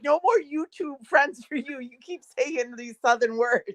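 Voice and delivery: retold mock telling-off; mock scolding. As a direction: imitate friends playfully laying down the law. Friends' mock ban, retold.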